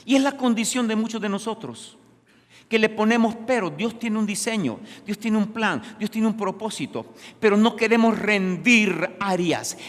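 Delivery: high, loud pulpit exhortation; full-force preaching. Real, not acted. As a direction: preach it out hard to the congregation.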